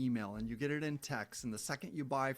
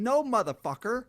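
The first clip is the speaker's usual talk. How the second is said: angry outburst; 9 semitones higher, 9 dB louder than usual. Real, not acted. Snapping back at pushy seller.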